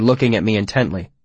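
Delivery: plain reading voice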